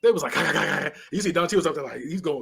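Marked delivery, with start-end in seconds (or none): 0.4-0.9 rapid-fire "kakakakak"; stuttering burst of gun sounds; mock gunfire noise. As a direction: imitate a machine gun with the mouth. Imitates rapid gunfire of drive-by.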